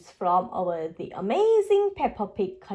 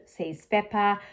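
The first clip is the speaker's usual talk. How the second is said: ordinary delivery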